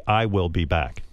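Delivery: low, slow, mechanical I will be back; every word separate like a machine; robotic computer reading. Retold or imitated. Actor showing how a computer says the line, machine-like. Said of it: "a computer would say".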